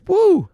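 high, loud mimicked whoop; imitated woo. Comic mimics heckler answering the fetish question, loud high woo. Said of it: "so he goes".

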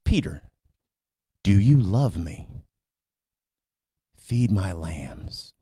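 slow, low and tender; a quiet, loving last question. Retold or imitated. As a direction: ask it gently, lingering on each word. Jesus asks the third time.

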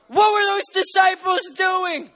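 high, slow, shouted challenge; preaching shout. Real, not acted. Preacher demanding an answer from the congregation, shouting. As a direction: hurl the question at the crowd.

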